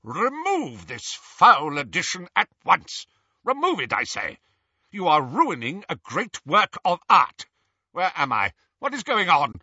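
high, loud, imperious outrage; indignant old portrait. A narrator's reading. Blindfolded portrait protesting to his captors, indignant and imperious. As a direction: demand it like an affronted headmaster.

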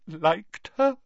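put-on high old voice, choked up; tearful. A narrator's reading. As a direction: echo it in tearful disbelief. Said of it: "his eyes brimming with tears once more".

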